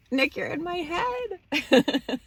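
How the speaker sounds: slow, very high, silly voice; playful squeal. Pretend. Joking about someone being in her head, silly squeal. Joking aside after demon specks.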